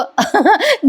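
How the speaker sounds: short rising giggle; a quick high giggle. Real, not acted. Vlogger laughing at the scene, high giggle. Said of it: "sorry हो प्रेक्षकांनो".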